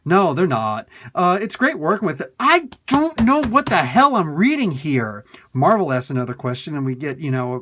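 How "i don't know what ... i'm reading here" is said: loud, high-pitched cry of bafflement; exasperated outburst. Real, not acted. Reader giving up on a confusing interview, exasperated outburst. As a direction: burst out loudly, fed up and baffled.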